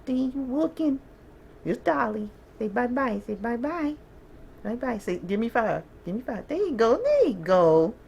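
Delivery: very high, squealy pet voice. Real, not acted. Owner coaxing her dog Dolly to face the camera, squealy baby talk. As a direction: coax the dog sweetly to look.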